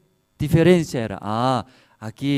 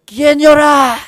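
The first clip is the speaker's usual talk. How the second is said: high, very loud yell over the din; exasperated shout; 13 semitones higher, 15 dB louder, slower than usual. Retold or imitated. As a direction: shout it out, can't stand the crying. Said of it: "yo también no podía aguantar". (grito exasperado, agudo y fuerte)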